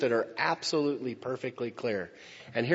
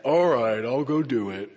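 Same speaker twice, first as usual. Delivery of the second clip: grudging regret; 4 dB louder than usual. Retold or imitated. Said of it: "with anger and regret".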